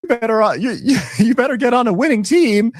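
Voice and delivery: swaggering falsetto boast; sassy mock brag. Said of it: "She'll say".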